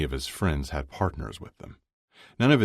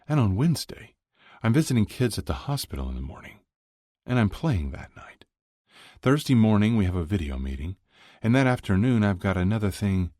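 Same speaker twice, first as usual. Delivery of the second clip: higher, brisk run through his schedule; listing his week, lightly in character; lightly voiced busy boyfriend; 6 semitones higher, 3 dB louder than usual. Scott lists his week's commitments to Kip, lightly voiced.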